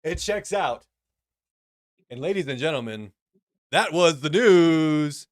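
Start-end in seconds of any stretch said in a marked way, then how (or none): 2.1-5.3 slow, loud anchor sign-off; news sign-off. Acted. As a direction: announce it grandly, slowly. Signing off as anchor.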